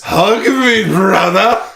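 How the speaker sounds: high, loud mock heroic cry; hammed-up kaiju plea. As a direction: bellow it high as a joke monster.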